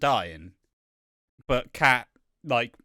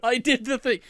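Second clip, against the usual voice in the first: whiny Sam; 12 semitones higher, 7 dB louder than usual. Retold or imitated. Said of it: "And so Sam's like".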